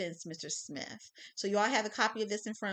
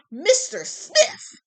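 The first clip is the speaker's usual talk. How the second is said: slow, much higher and louder; dramatic accusation; 13 semitones higher, 16 dB louder, much slower than usual. Acted. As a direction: hurl the name like an accusation. Prosecutor names suspect dramatically.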